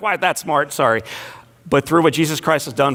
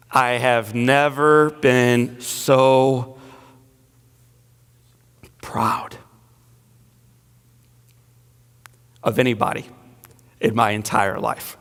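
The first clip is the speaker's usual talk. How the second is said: tender father; slower than usual. Retold or imitated. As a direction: hold back tears, let proud fall to a breath. Father's tender words to burned son.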